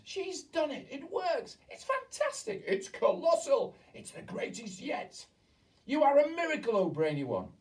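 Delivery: high, loud excited cheers; cheering. A narrator's reading. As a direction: rave over the witch's triumph. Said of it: "yelled the audience".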